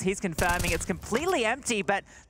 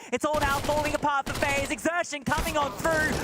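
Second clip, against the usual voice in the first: fast live play-by-play call; esports caster; 3 semitones higher than usual. Acted. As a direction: call the action as it happens.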